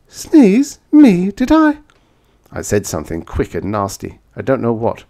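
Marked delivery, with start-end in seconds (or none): 0.1-1.8 high, loud, startled denial; frightened. A narrator's reading. The frightened woman denies sneezing, high and alarmed. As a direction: squeak out a scared denial. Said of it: "She looked frightened".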